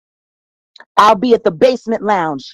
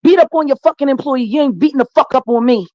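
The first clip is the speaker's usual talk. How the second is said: furious rant; 3 semitones higher than usual. Real, not acted. Talk guest cursing out [name], furious rant. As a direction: tear into him with real anger.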